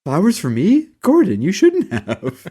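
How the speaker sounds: high, drawn-out put-on voice; mock flattered character. Host playing someone handed flowers, high mock-flattered voice. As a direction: gush it high, stretching the end.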